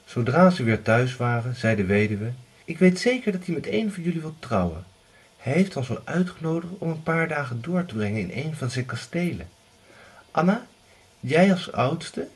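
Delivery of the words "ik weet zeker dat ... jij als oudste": higher, coaxing mother's voice; pushy mother. Acted. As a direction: nudge the eldest toward him. Widow pushes Anna to marry.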